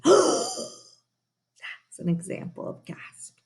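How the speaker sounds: sharp high gasp, then slow words; demo gasp. Shown as a demonstration. Teacher showing pupils how to gasp, high and slow. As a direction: show the class a big gasp. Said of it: "Practice it".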